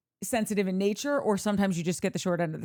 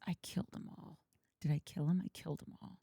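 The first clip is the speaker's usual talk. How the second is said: whispered quote; 7 semitones lower, 13 dB quieter than usual. Retold or imitated. Quoting the documentary killer.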